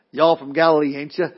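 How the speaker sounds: light mock country accent for a Galilean; a hint of Southern folksiness standing in for Galilee; Galilean accent as folksy twang. Bystander spotting Peter's accent, lightly mocked Galilean country talk. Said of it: "He had an accent from Galilee".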